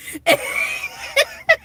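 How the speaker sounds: squealing high-pitched laugh. Real, not acted. Laughs after acted warning.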